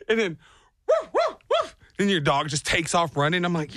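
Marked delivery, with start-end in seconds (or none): 0.9-1.8 high-pitched pretend woofs; imitated dog bark. Dog running up to fallen owner, high mock barking. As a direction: yip like an excited dog.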